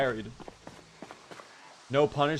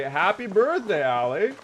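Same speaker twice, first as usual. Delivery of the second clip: singsong birthday greeting; 6 semitones higher than usual. Real, not acted. Greets a viewer on her birthday.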